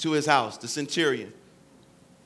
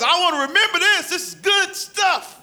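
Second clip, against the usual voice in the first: delighted God voice, laughing; 9 semitones higher than usual. Acted.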